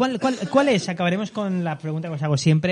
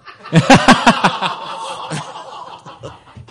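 loud laugh; 5 semitones higher, 8 dB louder than usual. Real, not acted. Laughing at geography joke.

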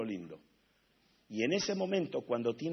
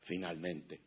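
tender voice of God; 2 semitones lower, slower than usual. Retold or imitated. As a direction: speak it gently like a loving father. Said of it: "Te va a decir".

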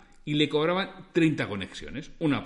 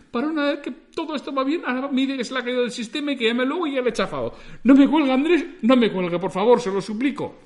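loud, high, fast, laughing pleas; loud high-pitched laughing supplication; desperate begging; 11 semitones higher, 8 dB louder than usual. Acted. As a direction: beg the operator like a panicked man. Customer begs operator not hang.

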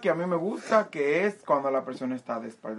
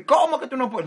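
mock insistent suitor; 10 semitones higher, 5 dB louder than usual. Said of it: "y tú me vienes a mí".

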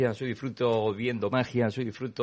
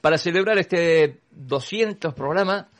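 excited announcement; 6 semitones higher, 7 dB louder, slower than usual. Real, not acted. Festive announcement of anniversary.

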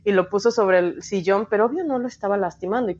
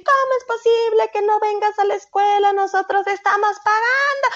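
retold yelling; 12 semitones higher than usual. Aunt scolding her niece outside school, high imitated yell. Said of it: "me grita esta pendeja".

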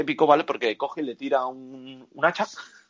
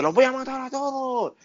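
mock battle cry; 11 semitones higher than usual. Voicing the girl charging out.

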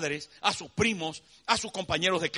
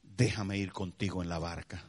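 much lower, soft pleading; humble plea; 11 semitones lower, 4 dB quieter than usual. Acted. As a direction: ask the Lord humbly and softly. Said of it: "le dice". (el hombre sanado suplica a Jesús, suave)

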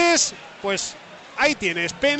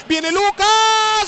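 drawn out, higher and louder; rising goal call; 7 semitones higher, 4 dB louder, much slower than usual. Real, not acted. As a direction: build the call as the shot goes in. Goal build-up commentary.

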